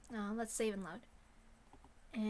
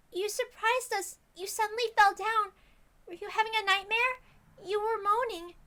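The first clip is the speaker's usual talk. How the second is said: put-on much higher, gentle worried girl voice; worried girl Rena; 11 semitones higher, 5 dB louder than usual. Rena checking on Keiichi after he collapsed, worried.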